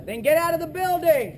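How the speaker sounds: high, loud urgent yell; urgent shout. Real, not acted. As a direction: shout it up high to hurry him. Urging teammate to move.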